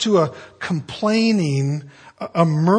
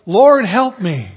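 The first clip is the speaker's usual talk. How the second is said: pleading cry; 4 semitones higher, 7 dB louder, slower than usual. Retold or imitated. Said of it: "you can't say".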